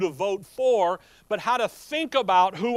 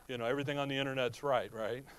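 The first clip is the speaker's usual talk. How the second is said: low, quiet deadpan mock agreement; sarcastic mutter under the breath; sarcasm; 7 semitones lower, 7 dB quieter, faster than usual. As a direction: say it dry, clearly not meaning it. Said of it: "that's sarcasm".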